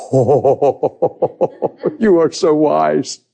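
slow, laughing admiration. Retold or imitated. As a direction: laugh it out slowly in delight. Laughing reply to God.

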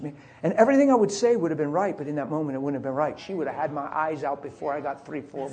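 usual speaking voice

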